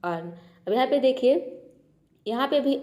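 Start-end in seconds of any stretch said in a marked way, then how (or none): 0.0-0.3 अर्न said with a short a and a half r; अर्न, clipped half r then full n; word demo. Shown as a demonstration. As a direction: blend अर्न as ur-n, with the r barely there. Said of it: "आर का हो गया आधा र".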